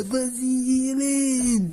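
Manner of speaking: long, high, drawn-out yell; coach's urging yell. Acted. Dictator coach pushing the team, mock drawn-out yell. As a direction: drive the team on, stretching each word.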